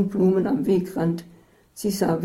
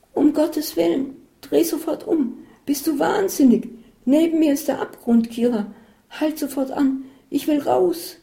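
much higher, louder shrill panic; panicked outburst; 10 semitones higher, 4 dB louder than usual. A narrator's reading. Isabel panicking at Katharina on the cliff road, high and frantic. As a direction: demand she turns back at once.

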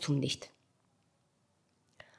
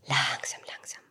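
leise, sanft beruhigend, zuletzt geflüstert; 6 semitones lower, 9 dB quieter than usual. A narrator's reading. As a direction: ganz sanft und leise zureden. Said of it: "Diese sanfte, alles heilende, wunderschöne Stimme". (sanfte, heilende Stimme, fast geflüstert)

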